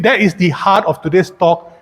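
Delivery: normal talking voice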